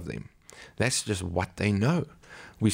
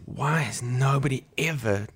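higher, slow, emphatic angry quote; angry demand; 6 semitones higher than usual. Retold or imitated. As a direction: imitate an angry pastor's indignant question. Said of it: "he was so angry".

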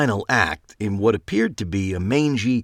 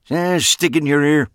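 retort; 7 semitones higher, 5 dB louder than usual. A narrator's reading. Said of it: "Max shot back".

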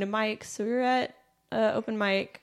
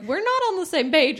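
higher, louder mock objection; protesting; 7 semitones higher, 6 dB louder than usual. Retelling the couple answering the roommate's marriage announcement, loudly protesting. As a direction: push back in a raised retold voice.

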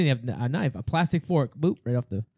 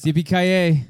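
higher, louder movie-hero shout; action-hero catchphrase; 5 semitones higher, 5 dB louder, slower than usual. Shown as a demonstration. Friend finishing the movie line, hammed up. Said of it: "Finish the sentence".

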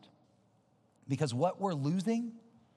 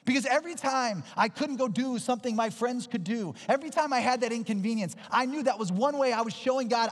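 raised, high-pitched sermon voice; impassioned preaching; 10 semitones higher, 5 dB louder than usual. Acted. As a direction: preach it with driving conviction. Preaches at full force.

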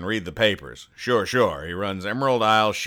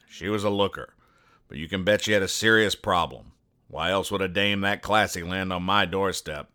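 noir detective. Acted. Noir private eye narration.

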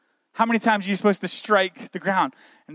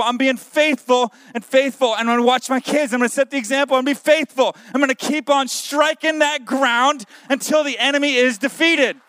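full-force preaching; 3 semitones higher than usual. Acted. Pastor urging the standing church to stay faithful, preaching at full force. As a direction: whip the standing crowd up with every faithful.

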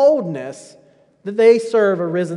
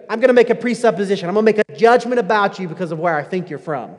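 mock judgment; faster than usual. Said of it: "you hear the accent and you go".

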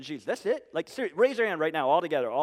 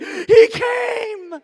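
a very high, loud, frightened cry; panicked shout; 14 semitones higher, 11 dB louder than usual. Retold or imitated. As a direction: shout in sudden panic, as a scared child. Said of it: "and I was like".

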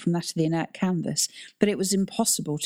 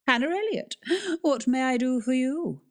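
haughty librarian; 8 semitones higher than usual. Acted. Haughty librarian greets Nan.

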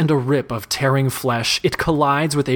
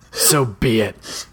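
resigned; slower than usual. Acted. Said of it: "he thinks".

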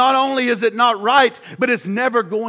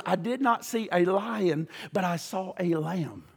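hushed reading; 4 semitones lower, 10 dB quieter than usual. A narrator's reading. Quiet reading of John's vision.

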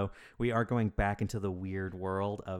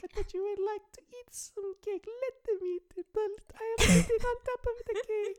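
very high squeaky falsetto; tiny Marie Antoinette; 6 dB louder than usual. Pretend. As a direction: squeak like a miniature queen on a cake. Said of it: "small Marie Antoinette".

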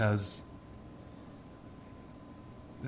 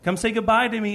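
put-on high, raised-voice call to a child; calling to him; 10 semitones higher, 5 dB louder, slower than usual. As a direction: call your kid over from across the house. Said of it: "I'm calling to him".

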